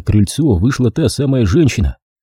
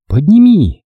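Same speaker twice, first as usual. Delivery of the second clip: plain reading voice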